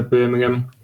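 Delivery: ordinary talk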